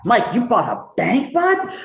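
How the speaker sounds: high, disbelieving jab at a friend; incredulous teasing. Retold or imitated. Asking Mike over cheesecake, incredulous and high. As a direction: tease him like he can't be serious.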